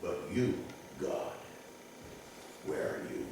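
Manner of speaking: soft whisper, almost no voice; same lonely man, still whispering; whispered question. Retold or imitated. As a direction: ask God quietly where he is. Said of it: "And then he adds".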